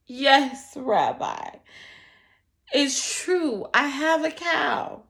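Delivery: loud, anxious answer; fearful. Acted. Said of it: "said the poor man fearfully".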